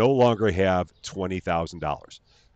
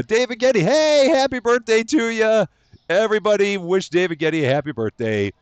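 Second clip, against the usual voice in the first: high, sing-song hellos to each viewer; cheery stream greeting; 13 semitones higher, 7 dB louder than usual. Real, not acted. Streamer welcoming chat members by name, high and sing-song.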